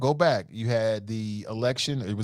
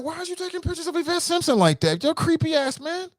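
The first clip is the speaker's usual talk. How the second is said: much higher, scolding his friend; mock alarm, pitched way up; high-pitched retold scolding; 13 semitones higher than usual. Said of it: "I'm like".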